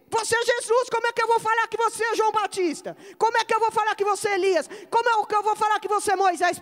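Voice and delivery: high, insistent preaching cry; indignant protest. Acted. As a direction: protest as if it were absurd. Acts out indignant reply to Jesus.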